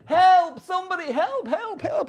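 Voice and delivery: high, desperate cries for help; high mock cries for help; shouting for help. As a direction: yell up from the bottom of a well. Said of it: "you're shouting".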